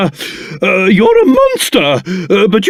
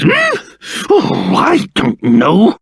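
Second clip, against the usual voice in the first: usual speaking voice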